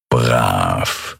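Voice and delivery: low, drawn-out praise for a servant; a low, self-satisfied master's voice; Chasu, furry creature master. A narrator's reading. Chasu praises his servant who offers salmon bites, low and pleased.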